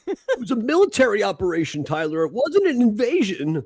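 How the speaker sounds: high, loud mocking objection; mock protest. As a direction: parody an indignant semantic correction. Mocking a semantic defence of invasion.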